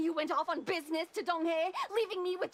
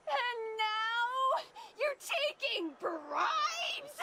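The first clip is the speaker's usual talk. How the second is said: slow, higher, weepy whine; tearful reproach; 7 semitones higher, much slower than usual. Acted. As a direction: drag out the hurt, near tears. Wife scolding tearful husband.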